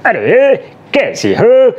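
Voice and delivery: playful high-pitched dubbing voice for a kids' cartoon. Shown as a demonstration. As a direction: chirp it like a cartoon character. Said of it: "जैसे मैं dubbing भी करता हूँ".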